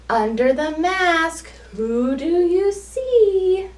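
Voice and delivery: put-on lively sing-song storytelling for small children; picture-book read-aloud. A narrator's reading.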